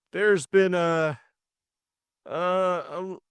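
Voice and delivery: high, drawn-out stammering; flustered hesitation. A narrator's reading. George explaining to the household, flustered. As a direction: stall on the little word, lost for an explanation.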